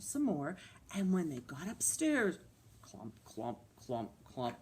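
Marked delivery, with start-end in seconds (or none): 2.8-4.6 low, soft clump sounds; soft, low thudding clumps; clumping footsteps. Acted. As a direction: imitate heavy bear steps, low and hushed. Imitating bears climbing the stairs.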